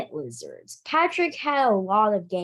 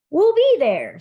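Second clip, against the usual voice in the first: eager reply; 8 semitones higher, 7 dB louder than usual. Acted. Henry eagerly accepts invitation.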